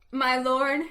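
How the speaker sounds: plain reading voice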